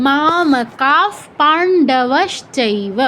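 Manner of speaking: মামকাঃ পাণ্ডবশ্চৈব very slowly, মামকাঃ ending in f; visarga by following letter. Shown as a demonstration. Teacher modelling for learners, slow মামকাঃ ending in f. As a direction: stretch each syllable, "sh" before চৈব in পাণ্ডবশ্চৈব. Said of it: "এরকম".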